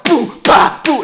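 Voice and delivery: high, loud vocal drum imitation; mock rock drums; loud mock drum beats. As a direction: bang out the drums with your voice. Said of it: "drums on it that are like".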